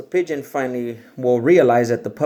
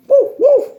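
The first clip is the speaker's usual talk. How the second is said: very high, loud yappy barking; puppy bark; 11 dB louder than usual. Acted. The puppy barks at the pigeon, high and yappy.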